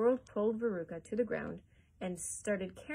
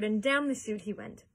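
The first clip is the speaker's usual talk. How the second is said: usual speaking voice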